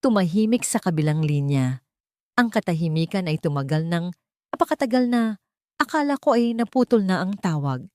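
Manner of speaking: usual speaking voice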